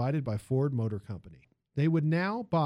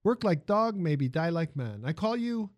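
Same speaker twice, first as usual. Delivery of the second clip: put-on lively Italian accent, raised pitch; 3 semitones higher than usual. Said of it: "the music of the man's accent".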